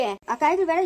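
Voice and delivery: usual speaking voice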